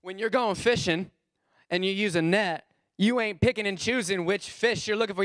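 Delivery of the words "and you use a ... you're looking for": ordinary talk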